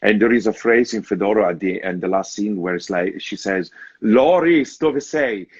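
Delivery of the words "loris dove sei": anguished, high and louder call; despairing cry. Retold or imitated. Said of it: "she said it with such a despair in the voice".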